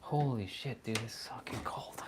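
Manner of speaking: hushed whisper. Real, not acted. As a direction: whisper it back indoors. Back inside, quiet.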